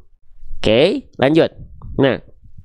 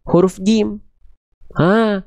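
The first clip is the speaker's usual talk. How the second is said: usual speaking voice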